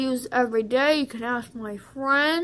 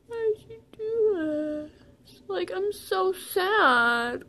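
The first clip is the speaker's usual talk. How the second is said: high, drawn-out mock wail; hammed-up tearful complaint; pretend bawling; 5 semitones higher, slower than usual. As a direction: whine it slowly as if in tears. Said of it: "to look like you're bawling".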